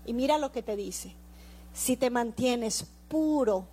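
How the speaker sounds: loud fervent preaching; preaching at full force. Acted. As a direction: preach it out to the congregation. Predica con fuerza. (predicación a plena voz)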